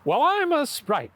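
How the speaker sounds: high, slow sprite voice. Retold or imitated. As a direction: announce yourself as a sprite. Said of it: "your impression of a sprite".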